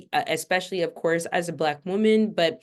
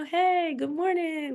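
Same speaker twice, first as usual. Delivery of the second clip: bright, much higher, sing-song good morning; vibrant greeting; 10 semitones higher, slower than usual. Retold or imitated. Teacher greeting her class with vibrant energy. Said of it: "a little vibrant".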